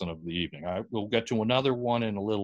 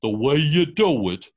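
put-on slow, louder lecturing voice of the old experts; mock know-it-all; 3 semitones higher, 8 dB louder than usual. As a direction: lay it down like a pompous authority. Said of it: "basically said".

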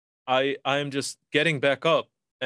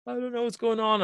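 retold crying; 9 semitones higher than usual. Calling his wife from far away, tearful and pitched high. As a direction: voice jumping much higher, close to tears. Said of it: "I'm, like, fucking crying and emotional".

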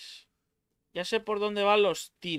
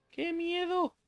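mock fright; 13 semitones higher, 4 dB quieter, slower than usual. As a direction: repeat the high hammed-up scared squeak. Repeats mock fear. (miedo fingido, agudo)

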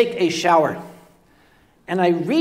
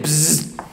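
a short mimicked insect buzz; imitated buzzing; 5 semitones lower, 4 dB quieter than usual. Mimicking the mystery buzz he hears in the bathroom, lips buzzing. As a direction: buzz through the lips like a bug. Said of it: "there it was again".

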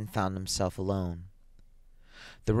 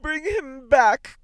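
high, loud, slow wail; desperate mother's high cry; 7 dB louder, much slower than usual. A narrator's reading. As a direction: wail the command, stretching each word. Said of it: "cried the old woman".

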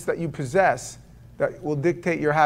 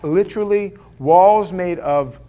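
normal talking voice

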